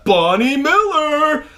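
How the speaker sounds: shout-out. Real, not acted. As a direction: call the name out across the room. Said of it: "shout all these out".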